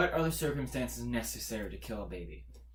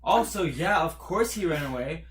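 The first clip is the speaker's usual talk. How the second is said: ordinary delivery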